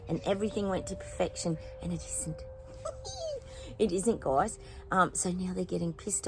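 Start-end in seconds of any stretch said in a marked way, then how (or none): 2.5-3.7 high gleeful laugh. Real, not acted. Laughing at the elites' failure.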